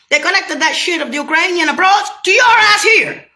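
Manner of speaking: high, loud furious outburst; rising into a real angry yell; angry shout. Real, not acted. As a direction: shout it at them in rage. Angry rant at listener.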